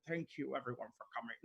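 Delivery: soft, lightly imitated Scottish star; Sean Connery. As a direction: drop the volume for a mock film-star thank-you. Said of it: "you literally did Sean Connery's voice".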